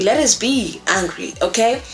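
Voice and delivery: ordinary talk, nothing marked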